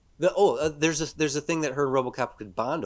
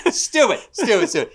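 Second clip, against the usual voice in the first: mock scolding; 11 semitones higher, 10 dB louder than usual. Mock scolding after laughing.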